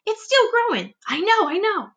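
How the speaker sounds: high, breathless excitement; excited squeal. Acted. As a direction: squeal at the growing peach. Aunts excited.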